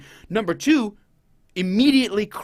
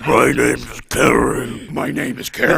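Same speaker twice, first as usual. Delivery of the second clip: possessed demon voice; 4 semitones lower, 5 dB louder than usual. Pretend. Joking that Stephanie is possessed, a mock loud demon voice. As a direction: play a hammed-up demon taking over. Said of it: "She's like".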